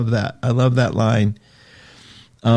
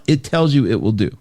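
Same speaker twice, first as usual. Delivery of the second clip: ordinary delivery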